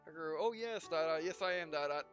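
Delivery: usual speaking voice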